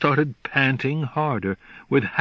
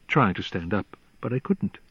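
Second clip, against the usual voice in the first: usual speaking voice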